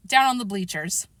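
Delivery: plain reading voice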